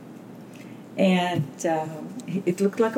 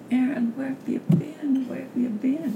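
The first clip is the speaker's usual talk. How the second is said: higher-pitched retold worried wife; imitating an anxious "where were you"; worried questioning; 6 semitones higher than usual. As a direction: press him anxiously, as in a retelling. Worried wife questions late-returning husband.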